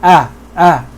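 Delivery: "Ah, ah" with the thin a of "cat"; light fatha vowel. Shown as a demonstration. Teacher showing students the light fatha, clearly demonstrated thin vowel. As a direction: say "Ah" open and fronted, like the vowel in "bat". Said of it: "Listen to the way I'm pronouncing the fatha".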